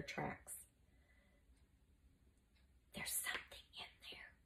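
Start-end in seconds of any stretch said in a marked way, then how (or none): 2.9-4.3 tense hushed whisper. A narrator's reading. Mucius hears groaning in the wardrobe, whispering. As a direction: whisper it under your breath. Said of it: "Mucius whispered".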